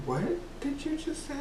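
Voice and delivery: imitated shock, high and very soft; hushed disbelief. Teacher stops everything at the pupil's question, hushed disbelief. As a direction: stop everything and ask it in a high hush.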